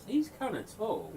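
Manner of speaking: high, slow, dopey squeak as the hammer; squeaky talking hammer muttering mid-flight; hammer's voice. Acted. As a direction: voice the thrown hammer as a dim little thing. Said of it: "leaves your hands and goes".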